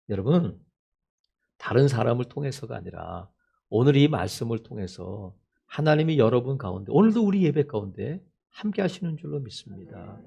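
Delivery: low, soft preaching voice; solemn pastoral appeal. Real, not acted. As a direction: bring it down to a quiet, earnest appeal. (낮고 조용하게 진지한 권면)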